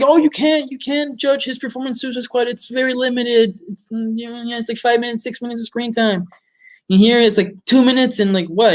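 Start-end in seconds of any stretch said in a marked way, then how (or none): 0.0-6.3 mocking fans. Reviewer mocking fans defending Leto, high whiny mockery. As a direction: mimic the fans' defensive whine. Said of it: "people were saying, like".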